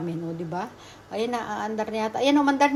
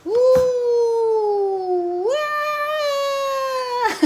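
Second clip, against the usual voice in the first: very high, loud scream; thrilled scream; 12 semitones higher, 4 dB louder than usual. Real, not acted. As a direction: scream as the ride plunges. Ride dropping.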